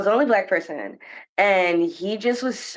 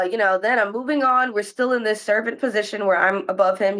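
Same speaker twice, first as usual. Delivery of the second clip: ordinary talk, nothing marked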